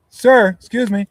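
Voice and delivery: high, loud call after him; loud polite call. Retold or imitated. Flight attendant calling Woody back. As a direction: shout politely to stop him. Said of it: "And she goes".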